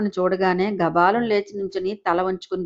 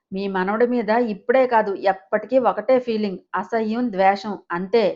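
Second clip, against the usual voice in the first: usual speaking voice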